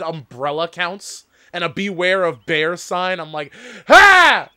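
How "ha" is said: triumphant shout. Real, not acted. Mocking the game's bear sign.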